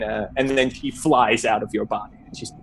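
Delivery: ordinary talk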